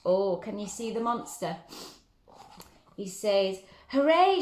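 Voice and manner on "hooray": high, loud cheer; excited shout. Acted. George finding the monster, high loud cheer. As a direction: cheer it out loud like an eager boy. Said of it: "shouted George".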